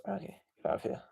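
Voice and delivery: quick whispered dismissal. Real, not acted. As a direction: whisper it aside to shoo it off. Dismissing the plush after its lines.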